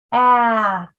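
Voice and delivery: AT drawn out, sound by sound; sounds stretched apart. Shown as a demonstration. Teacher arm-tapping a word for parents, sounds stretched apart. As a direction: stretch the vowel of AT before the final T. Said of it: "let's arm tap it".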